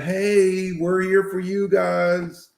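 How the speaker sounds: high, drawn-out welcoming pitch; mock friendly outreach. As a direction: stretch it out like a company making a peace offer. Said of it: "What a good way to show your hand".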